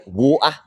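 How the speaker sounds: water as "WAW-uh", glottal stop for the T; dropped T. Shown as a demonstration. Challenging Americans to say water, with the T dropped. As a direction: say water with no T and no final R. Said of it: "at least we say it with the T".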